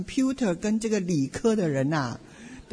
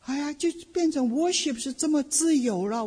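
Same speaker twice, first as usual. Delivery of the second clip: normal talking voice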